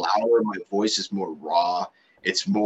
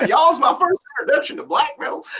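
very high-pitched mock Southern accent; hammed-up Southern twang, breaking into laughter; 5 dB louder than usual. Bandmate repeating the band's running joke, in a mock Southern accent. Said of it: "got the Southern accent and everything".

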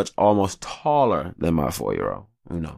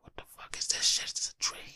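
whispered disbelief; 11 dB quieter than usual. Retold or imitated. Seeing dad play Ninja Turtles.

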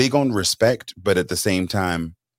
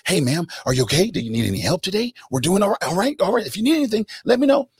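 high, sweet helpful clerk voice, much higher pitch; mock eager store clerk; 10 semitones higher than usual. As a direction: play the overly friendly clerk chasing the wife. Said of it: "And so she'll be there".

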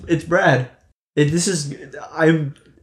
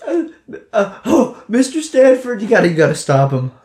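high, choked-up plea; tearful plea; 7 semitones higher than usual. Acted. Ghost Brad tearfully begs her to stop Stanford, choked and high. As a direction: beg through near tears. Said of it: "he's like".

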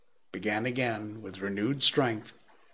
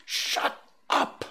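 hissed whispered command; terrified whisper; 3 dB louder than usual. A narrator's reading. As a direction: silence him without being heard. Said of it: "in a terror lest the Martians should hear us".

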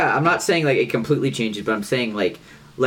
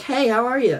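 much higher friendly greeting. Retold or imitated. Greeting girls at the register in a raised voice. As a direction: voice jumps way up on "Hey". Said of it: "my voice would go up a little bit".

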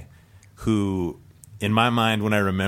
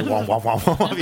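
imitated dubstep bass noise, pitch climbing; mock dubstep womps; 3 semitones higher, 4 dB louder than usual. Joking with friends about the club's music, mock womping. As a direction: womp like a silly dubstep drop. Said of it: "That was the noise [name] just made".

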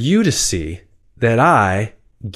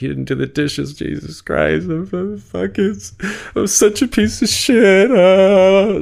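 climbing much higher into a mock shriek; hammed-up self-loathing over dishes; self-shaming outburst; 10 semitones higher than usual.

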